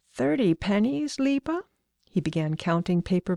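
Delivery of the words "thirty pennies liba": questioning. Acted. Father checking the price.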